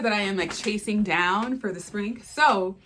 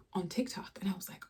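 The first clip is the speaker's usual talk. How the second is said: whisper; 13 dB quieter, faster than usual. Real, not acted. Whispered aside before quote.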